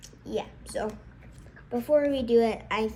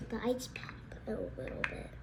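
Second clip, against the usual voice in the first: whispering; 3 semitones lower, 11 dB quieter than usual. Real, not acted. Aside while smelling drink.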